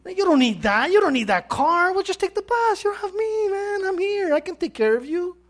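Italian husband brushing off his wife's wants; Italian accent. Retold or imitated. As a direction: play the Italian charmer, light and upbeat. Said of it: "imitate you something with Italians say".

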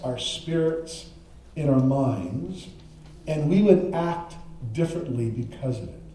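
prayer. Real, not acted. Pastor praying God's word comes alive, prayerful.

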